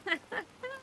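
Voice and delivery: high, soft mocking snicker; defiant snicker. A narrator's reading. Sister taunting her brother, defiant snicker. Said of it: "une sorte de ricanement de défi".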